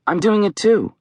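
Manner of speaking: high-pitched dismay; distressed. A narrator's reading. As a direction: protest in distress, voice pushed up. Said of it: "Mel says, distressed".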